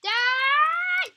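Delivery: loud, high roar of die; full-throated battle yell at the attacking zombie; furious battle shout. Real, not acted. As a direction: scream it at the enemy. Fighting a zombie.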